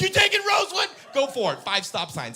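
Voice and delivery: high shouted jeer, settling as it goes; gleeful taunt. Acted. Driver mocking the other's route, gleeful taunting yell. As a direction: start at a yell and come down.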